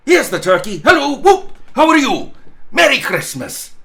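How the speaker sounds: high, loud, gleeful hollering; joyful calling out. A narrator's reading. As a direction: call out like a giddy old man on Christmas morning. Scrooge calls out joyfully.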